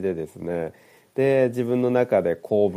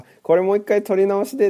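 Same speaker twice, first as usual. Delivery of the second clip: much higher, louder, blurting it out; mock blurted request; 12 semitones higher, 7 dB louder, faster than usual. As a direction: squeak out a hasty retake request. Retells own near-outburst in recording.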